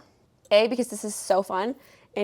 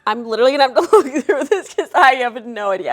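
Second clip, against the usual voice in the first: giggling; 4 semitones higher, 9 dB louder than usual. Real, not acted. Still giggling after clip.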